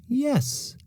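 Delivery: very high, sweet yes; music teacher. A narrator's reading. Teacher confirming to Victoria, lightly voiced.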